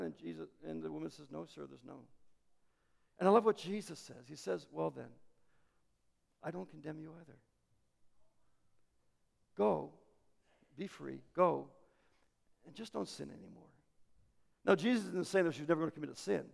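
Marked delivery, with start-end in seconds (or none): 1.3-2.0 much softer, small and hushed; quiet timid reply. Retold or imitated. Frightened woman answering Jesus, much softer and hushed. 4.7-13.6 much softer, gentle release; tender forgiveness. Retold or imitated. Jesus forgiving the accused woman, much softer and tender.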